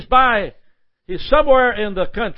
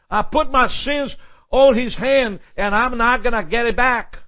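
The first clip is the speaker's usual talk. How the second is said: ordinary talk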